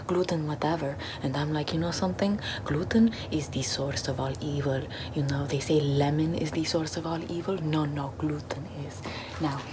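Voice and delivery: normal talking voice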